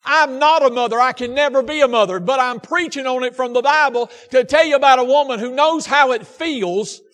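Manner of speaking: pressing each phrase on the congregation; impassioned preaching. Real, not acted. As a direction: preach it with force. Preaches at full force.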